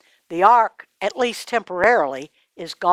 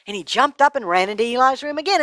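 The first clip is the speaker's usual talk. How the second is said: ordinary delivery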